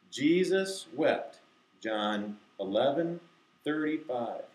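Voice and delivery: spaced-out verse recitation; slow, deliberate. Shown as a demonstration. Teacher reciting erased words with kids, slow and deliberate. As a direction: recite slowly, pausing between phrases. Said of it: "I have to say it slowly, deliberately".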